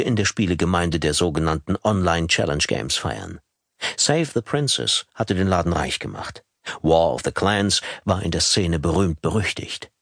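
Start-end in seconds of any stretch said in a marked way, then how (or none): none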